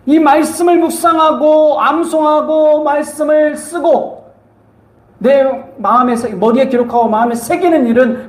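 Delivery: high, drawn-out exhortation; emphatic preaching. Real, not acted. Preacher urges congregation. (높고 길게 끌며 힘주어 권면)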